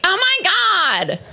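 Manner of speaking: high, drawn-out hysterical squeal; mock freak-out. Other women freaking out, mock hysterical. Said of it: "Other bitches freak out".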